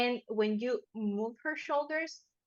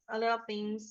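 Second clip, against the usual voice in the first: ordinary talk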